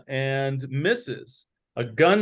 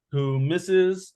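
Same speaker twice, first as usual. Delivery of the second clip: usual speaking voice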